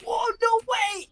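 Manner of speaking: high, loud mock amazement; imitation of a stunned, thrilled fan; starstruck fan. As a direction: exclaim like a fan who can't believe it. Acting out amazed fans meeting him.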